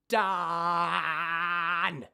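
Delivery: loud drawn-out scream on done; twang scream demo. Shown as a demonstration. Coach showing twang on a scream. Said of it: "I can still do ah, done".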